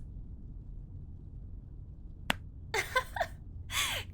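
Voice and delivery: high-pitched laughter; amused high cackle after a jab; teasing laugh. Acted. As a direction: burst into high giggles at the driver.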